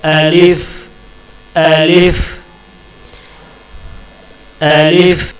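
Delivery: अलिफ spoken in isolation, ending on a clear f; Arabic letter alif. Shown as a demonstration. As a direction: model अलिफ for the students to repeat. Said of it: "इसको इस तरह से pronounce करेंगे". (अलिफ का अलग से साफ़ उच्चारण)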